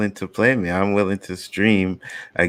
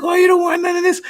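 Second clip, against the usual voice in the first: high-pitched mimicked taunting; mocking, high kid voice; trash talk; 5 dB louder, faster than usual. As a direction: imitate a kid talking smack. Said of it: "he is talking shit".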